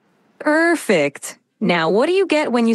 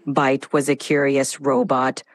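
lower, monotone robot narration; dramatic robotic voice; 7 semitones lower than usual. Acted. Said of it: "Initiating dramatic robotic voice".

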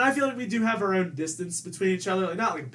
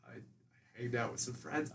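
slow, much softer and hesitant; mumbled evasive reply; 5 semitones lower, 11 dB quieter, slower than usual. Retold or imitated. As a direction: drag it out, dodging. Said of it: "I'm like".

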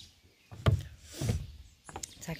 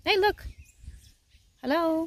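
calling down from tower; 7 semitones higher, 4 dB louder than usual. Real, not acted. Calling down to pet below.

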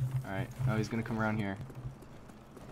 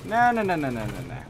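panicked no; 4 semitones higher, 5 dB louder than usual. Real, not acted. Chopper attack.

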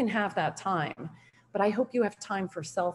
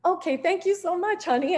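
high, laughing sugary thank-you; light laughing reply to husband; sweet laughing thanks; 11 semitones higher than usual. Retold or imitated. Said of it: "I just said".

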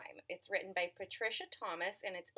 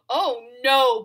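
loud bee cry; 3 semitones higher, 16 dB louder than usual. Acted. Bees cry out.

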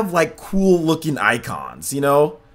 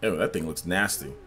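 ordinary talk, nothing marked

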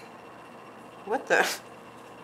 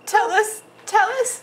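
high, teasing sing-song; playful teasing; 11 semitones higher, 4 dB louder than usual. Real, not acted. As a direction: coax it out playfully. Playful prodding, high pitch.

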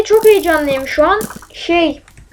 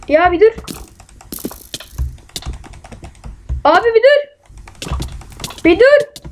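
pleading yell; 7 semitones higher than usual. Real, not acted.

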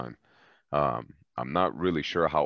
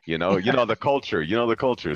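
laughing; 6 semitones higher, 7 dB louder than usual. Real, not acted. Laughing about older brother rule.

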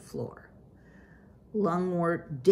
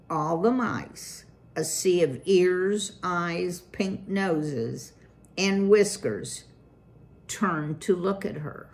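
ordinary delivery, nothing marked

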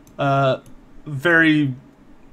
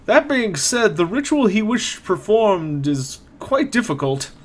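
put-on higher-pitched old man voice, troubled; worried old caretaker reporting news; a hint of a frowning old man; elderly man, frowning; 7 semitones higher than usual.

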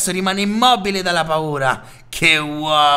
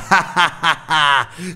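risata cattiva. Acted. Bullo ride lanciando.

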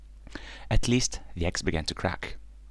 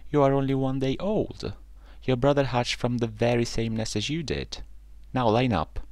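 higher-pitched motherly scolding; stern mother duck, voice lifted; 7 semitones higher than usual. A narrator's reading. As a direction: lecture the ducklings firmly. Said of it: "said Mama Duck in a stern voice".

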